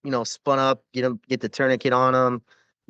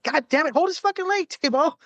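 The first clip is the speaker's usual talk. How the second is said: much higher frantic barked order. Retold or imitated. Medic ordering Tebow to keep traction, frantic high order. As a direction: snap at him to hold the leg, pitched way up. Yelling at Tebow to hold leg.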